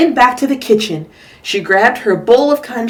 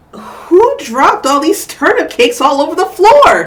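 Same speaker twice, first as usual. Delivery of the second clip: complaining Mister Chan; 7 semitones higher, 6 dB louder than usual. Acted. Father complaining in story.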